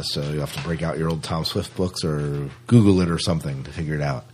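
usual speaking voice

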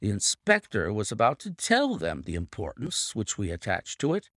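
plain reading voice

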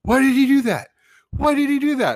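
high, loud falsetto wail of protest; imitated distraught kid, loud and very high; dismayed outburst. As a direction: wail it out, pitch way up, like an upset kid. Acting out son's dismayed outburst at film.

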